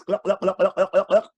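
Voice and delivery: rapid, high, repeated glugs; gulping noises. A narrator's reading. Storyteller imitating elephants drinking everything, fast glugging. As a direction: gulp noisily again and again. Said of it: "They drank all the drinks".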